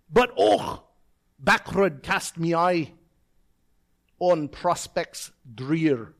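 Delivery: slow, dramatic Scots poem reading; Scottish accent. Acted. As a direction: recite slowly with a Scottish burr, pausing between phrases. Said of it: "in my best Scottish accent".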